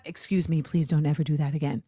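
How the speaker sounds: mock-calm, low and soft; polite, restrained request. Imitating a left-hanging performer asking nicely, restrained. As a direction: drop low and soft, contained. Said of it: "you don't have".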